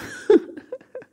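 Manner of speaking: high self-mocking giggle; laugh. Real, not acted. Vlogger admits she doesn't know names, high amused laugh. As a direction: burst into a real giggle.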